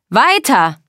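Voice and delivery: loud, high bark; angry command. Acted. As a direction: push them to go on. Er drängt drohend. (barsch befohlen)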